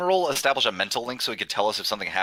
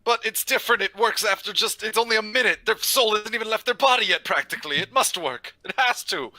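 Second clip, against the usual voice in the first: higher-pitched, insistent pleading; 7 semitones higher than usual. Acted. Player arguing the revival must still work, pleading.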